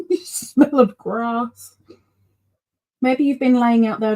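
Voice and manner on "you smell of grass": said through a real laugh, slow. Real, not acted. Repeating to her cat that it smells of grass, laughing through it.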